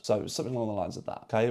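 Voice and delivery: normal talking voice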